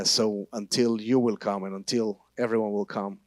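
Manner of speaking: normal talking voice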